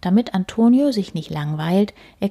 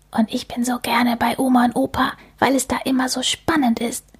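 put-on a breathy, excited child's whisper, pitched up; 5 semitones higher than usual. Antonio confides to Amelie about Grandma and Grandpa, whispered. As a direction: let the little boy whisper his secret. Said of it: "flüstert Antonio Amelie zu".

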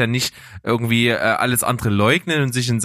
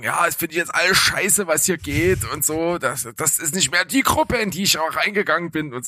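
mock whiny group member, higher; whiny quitter; 5 semitones higher than usual. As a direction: play the indignant quitter. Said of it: "Leute dann so einen Rappel kriegen und sagen".

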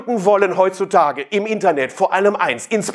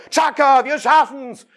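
hammed-up chant of the slogan, pitched higher; mock cheer; 6 semitones higher, slower than usual. Trainees back home chanting the slogan, mock cheer. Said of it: "dann macht ihr".

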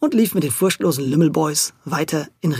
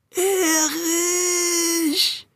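high, long wailing ghostly call; 12 semitones higher than usual. Acted. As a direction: howl the name like a ghost. Ghostly calls repeated at window.

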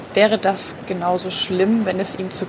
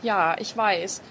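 ordinary talk

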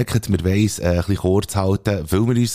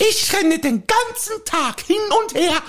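a higher, fussy housewife voice pestering her husband; nagging wife. Acted. Wife nagging her husband to go for a walk, fussy. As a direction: play the nagging wife in a comic sketch.

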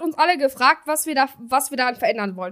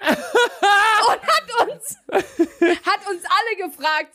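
laughing; 5 semitones higher, much slower than usual. Real, not acted. Laughing while retelling.

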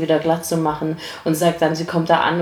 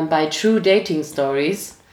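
ordinary talk, nothing marked

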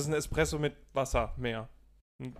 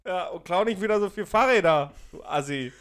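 loud, high brash farewell; mock shouting goodbye; 5 semitones higher, 9 dB louder than usual. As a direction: yell it jokingly.

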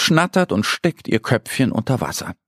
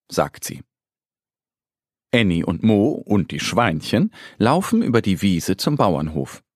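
usual speaking voice

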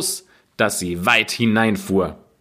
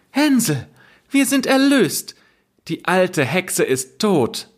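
put-on much higher, excited girl shouting the good news; joyful cry; 10 semitones higher than usual. Gretel freeing her brother, high and jubilant. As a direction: cry out with relief and joy. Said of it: "öffnete sein Ställchen und rief".

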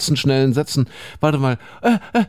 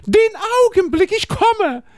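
calling out; 3 dB louder than usual. Acted. Juliet calls back offstage.

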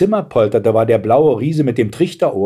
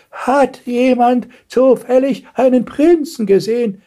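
put-on cracked old voice; 14 semitones higher, 3 dB louder than usual. A narrator's reading. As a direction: quaver it like an ancient lady. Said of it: "fragte sie mit brüchiger Stimme". (uralte Frau mit brüchiger Stimme)

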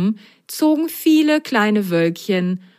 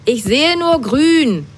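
high, loud, slow complaint; high loud grumpy whine; grumbling; 8 semitones higher, 5 dB louder than usual. Acted. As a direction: grumble it crossly. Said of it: "schimpfte Himmelblau".

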